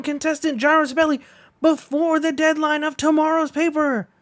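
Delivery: high, loud play-by-play; race commentary. Acted. Announcer calling the race as Gyro pulls ahead, excited. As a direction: call the race like it's neck and neck.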